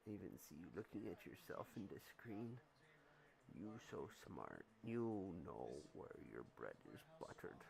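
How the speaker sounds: quiet low coo to the kitty; soft murmur to cat. Real, not acted.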